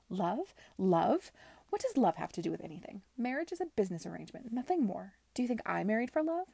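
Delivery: loud, fast, outraged tirade; furious rant. Acted. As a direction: scoff at love, fast and loud. Furious aunt ranting at niece.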